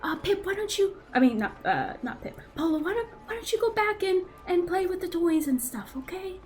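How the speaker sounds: gentle uncle. Acted. Pip sending boy away.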